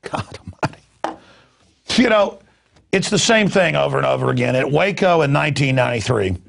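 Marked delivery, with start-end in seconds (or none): 0.0-1.2 breathy muttered aside. Real, not acted. Exasperated aside after tangled words.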